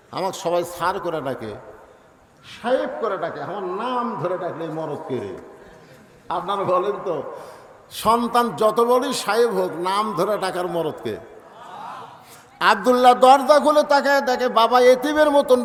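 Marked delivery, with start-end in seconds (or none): none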